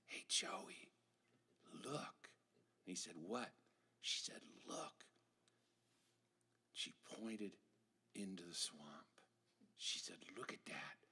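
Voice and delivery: soft, hushed whisper; whispering kids. Acted. Kids whispering at the swamp's edge, hushed. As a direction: breathe it secretly.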